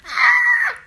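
sudden high shrill scream from down the hall. A narrator's reading. A boy screaming in the hallway, a startled shriek. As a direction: let out a piercing startled scream.

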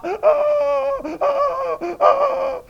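very high, loud squealing sobs; three wailing cartoon sobs; piglet sobbing. Little pig bursts into tears before the two girls, squealing sobs. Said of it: "le petit cochon versa de grosses larmes".

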